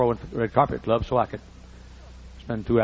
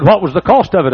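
high, loud query; the clerk pressing for a price; agent's voice; 7 semitones higher, 13 dB louder than usual. Retold or imitated. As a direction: agent asking the cost, raised and loud. Agent questioning at counter.

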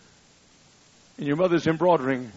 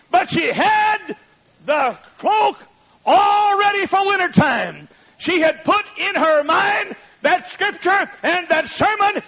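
high, loud, slow shouted preaching; preaching at full force; 8 dB louder than usual. Acted. Preacher urging the congregation to prepare, loud and high. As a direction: hammer the cloak image home.

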